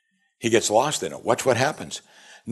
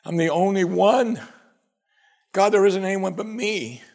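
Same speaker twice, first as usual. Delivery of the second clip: mock self-pity; 7 semitones higher than usual. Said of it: "Pity party".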